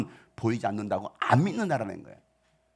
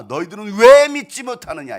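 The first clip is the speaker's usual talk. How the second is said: stern rebuke; 4 semitones higher, 11 dB louder than usual. Acted. Jesus rebuking his disciples, stern and loud. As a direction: thunder it as a reproach. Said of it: "책망하실 때는 왜 책망했습니까?".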